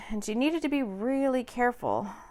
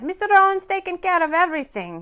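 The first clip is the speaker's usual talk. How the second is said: smiling driver; 8 semitones higher, 7 dB louder than usual. A narrator's reading. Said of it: "he smiled".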